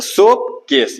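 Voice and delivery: सोप, short break, केस; two distinct pieces, सोप and केस; split into two syllables. Shown as a demonstration. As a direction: separate सोप from केस to count the beats. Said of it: "ये डाई syllable word है".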